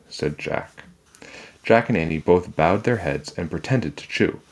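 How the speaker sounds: plain reading voice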